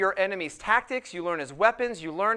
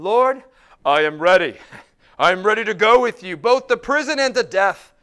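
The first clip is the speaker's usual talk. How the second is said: loud, booming avowal; bold declaration; 10 dB louder than usual. Acted. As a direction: declare it boldly at full voice. Acts out Peter's bold declaration.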